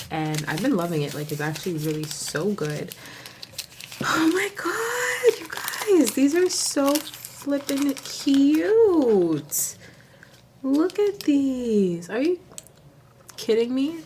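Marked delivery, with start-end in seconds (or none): 4.0-14.0 very high, slow and loud gushing; excited squeal. Real, not acted. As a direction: squeal over the cute foils. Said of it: "I'm so excited".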